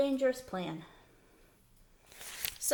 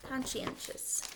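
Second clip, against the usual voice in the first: whispered word; 3 semitones higher, 7 dB quieter, slower than usual. Shown as a demonstration. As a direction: whisper the word Conscientious, slowly syllable by syllable. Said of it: "we've got this word conscientious".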